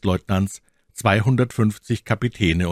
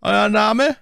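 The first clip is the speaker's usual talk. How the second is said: high, loud curt question; curt demand; 5 dB louder than usual. A narrator's reading. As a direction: demand the name. Officer demands name.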